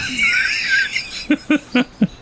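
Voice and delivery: high-pitched burst of laughter; real laughter. Real, not acted. Big laugh.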